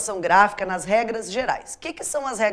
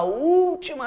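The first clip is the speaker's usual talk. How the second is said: ÚLTIMA with a lengthened, raised Ú; teacher leaning on the long Ú in última; drawn-out stress; 6 semitones higher, slower than usual. Shown as a demonstration. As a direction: hold the first vowel of última for emphasis. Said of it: "palavras que têm acento na".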